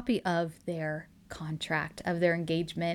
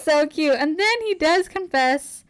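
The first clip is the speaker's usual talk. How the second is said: high, loud swooning over a romance; real gushing delight; 7 semitones higher, 6 dB louder than usual. Real, not acted. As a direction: gush loudly, pitch soaring, drawing it out.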